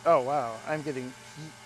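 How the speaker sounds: ordinary delivery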